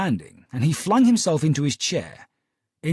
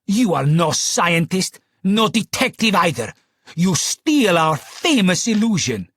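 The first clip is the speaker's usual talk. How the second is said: furious; 4 semitones higher, 5 dB louder than usual. Acted. Client to Holmes, furiously accusing him of theft. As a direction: spit out each accusation.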